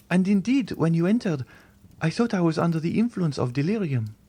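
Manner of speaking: put-on high, pleading young woman's voice; a terrified girl refusing to believe; frightened young girl. A frightened young Valentine begging the Count to leave her.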